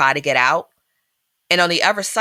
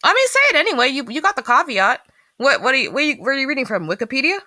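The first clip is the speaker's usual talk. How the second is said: eager prodding; 7 semitones higher, faster than usual. Real, not acted. Pushing cohost to share.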